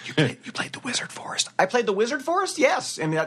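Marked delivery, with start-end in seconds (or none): 0.0-1.5 whispered prompt from the side; hushed, whispered tip-off; whispering. Retold or imitated. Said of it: "sitting between Fred Tatasciore and Misty Lee". 1.6-3.0 high surprised question; mock surprise, much higher. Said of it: "that's what we were doing".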